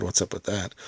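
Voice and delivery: ordinary talk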